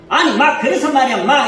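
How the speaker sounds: high, loud, rough "마" tone; wife speaking roughly at high pitch; harsh rough tone. Shown as a demonstration. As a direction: bark it out like a rough scolding. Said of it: "이렇게 하는 거".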